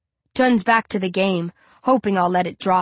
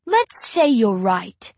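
slow, dragged-out and smug; drawl; much slower than usual. Acted. Narrator taunts Nancy, slow smug drawl. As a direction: stretch every word lazily. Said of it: "I drawl".

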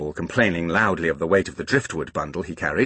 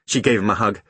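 ordinary delivery, nothing marked

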